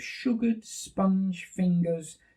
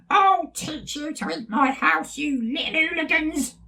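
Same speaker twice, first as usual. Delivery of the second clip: cackling witch; 13 semitones higher than usual. Acted. Witch catching the bears eating her house, shrieking. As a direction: cackle it like a wicked old witch. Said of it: "a voice cackled".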